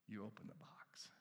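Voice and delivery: hushed, breathy secret whisper. Shown as a demonstration. Preacher to congregation, whispering the answer. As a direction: whisper it like a reveal. Quiet reveal, then repeated aloud.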